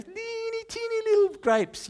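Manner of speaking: put-on very high squeak for teeny little grapes; tiny squeaky voice. Preacher to congregation, squeaky high voice for tiny grapes.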